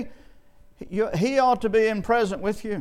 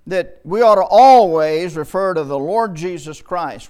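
ALWAYS hit loud and hard; imitated emphasis; 9 dB louder than usual. Preacher quoting another preacher's rule, with loud emphasis. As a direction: lean loudly on "always", carrying through "Lord Jesus Christ". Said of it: "puts a lot of emphasis".

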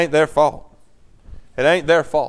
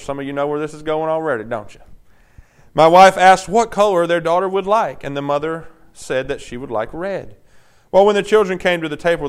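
normal talking voice

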